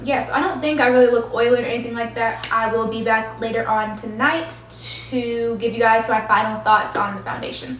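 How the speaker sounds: normal talking voice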